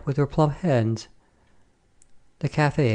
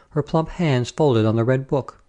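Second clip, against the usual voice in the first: ordinary delivery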